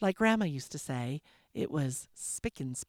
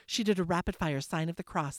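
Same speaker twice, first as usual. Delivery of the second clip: plain reading voice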